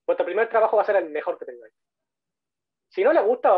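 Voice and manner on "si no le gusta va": emphatic warning. Real, not acted. Warning students about recruiters.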